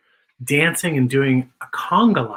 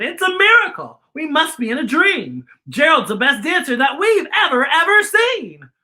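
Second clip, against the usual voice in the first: excited shout; 7 semitones higher than usual. A narrator's reading. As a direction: shout it like you can't believe your eyes. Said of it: "They shouted".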